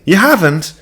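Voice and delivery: high, loud suspicious mother; Ma's voice. A narrator's reading. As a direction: pitch her high and pressing. Ma questions, high voice.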